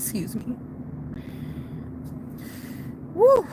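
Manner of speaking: softly choked up, pausing to recover; fighting back tears. Real, not acted. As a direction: hold back tears, overwhelmed. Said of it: "I'm trying not to cry".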